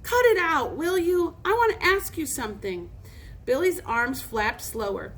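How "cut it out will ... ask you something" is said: higher-pitched nervous heartiness; hearty, quavering. A narrator's reading. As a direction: put on a hearty front, nervously. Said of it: "in a hearty, quavering voice".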